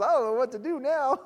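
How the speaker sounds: high, loud flustered cry for help; mock panic. Preacher imitating a flustered volunteer needing help, mock panic. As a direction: squeal it out in comic alarm. Panicked new volunteer after convert says yes.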